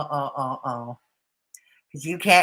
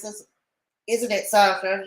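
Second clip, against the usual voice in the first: ordinary talk, nothing marked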